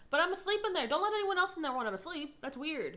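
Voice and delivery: high, loud outburst telling her off; upset shout. Retold or imitated. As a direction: yell it at the roommate who barged in. Telling off roommate for entering.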